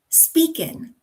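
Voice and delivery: speakin', ending in n instead of ng; slow speakin' with a cut-down ending; dropped ending. Shown as a demonstration. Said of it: "instead of ing, we get in".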